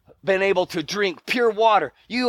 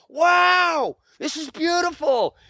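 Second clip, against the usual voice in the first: higher-pitched, drawn-out wonder; acted awe; 8 semitones higher, slower than usual. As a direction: marvel at a starry sky. Acting out awe under stars.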